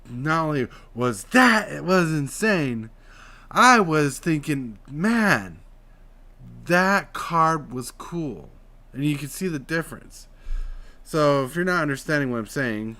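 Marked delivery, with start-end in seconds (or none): 0.1-8.5 higher, louder and slow, lifted into the head; talking through the head. Shown as a demonstration. Free voice lesson demo, slow heightened head voice. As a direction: lift the voice up into the head. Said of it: "imagine you're talking through your head".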